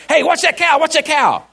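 high-pitched urgent call-out; strong enthusiasm. Retold or imitated. Rancher warning his helper about an escaping cow, strongly enthusiastic. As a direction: shout a quick retold warning. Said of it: "strong enthusiasm".

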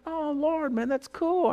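high, soft marvelling; pleased amazement. Retold or imitated. Man to the Lord on seeing turkeys, softly amazed.

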